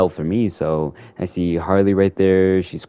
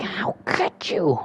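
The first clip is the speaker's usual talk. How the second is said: breathy whisper; 11 semitones higher, 6 dB quieter, slower than usual. Retold or imitated. Riddler, unvoiced.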